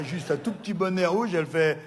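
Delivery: ordinary delivery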